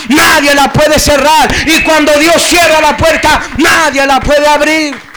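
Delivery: loud, impassioned preacher building to shouts; full-force preaching. Acted. Preacher promising God opens a better door, shouted preaching. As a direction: drive it relentlessly up to a shouted peak.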